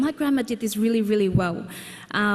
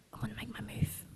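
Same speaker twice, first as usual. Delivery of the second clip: a secret whispered plan; whispered thought; 13 dB quieter than usual. Retold or imitated. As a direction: whisper it to yourself. Secret plan to hug grandma.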